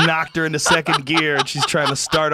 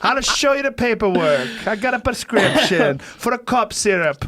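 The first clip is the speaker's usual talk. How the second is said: higher, hammed-up Italian accent; cartoonish Italian accent, pitched up; mock Italian accent; 4 semitones higher than usual. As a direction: play an indignant patient in broken Italian English. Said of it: "spoken with an Italian accent".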